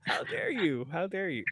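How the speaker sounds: high, soft theatrical offense; hammed-up indignation, kept quiet; mock outrage.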